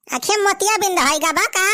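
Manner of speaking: usual speaking voice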